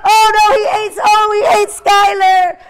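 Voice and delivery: high, loud mock-horrified wail; mock alarm. Teacher announces the yak ate Skylar, loud mock alarm. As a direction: cry out in playful dismay.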